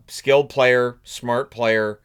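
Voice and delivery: ordinary talk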